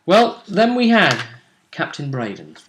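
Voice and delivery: ordinary talk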